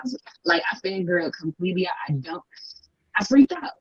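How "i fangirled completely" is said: ordinary talk, nothing marked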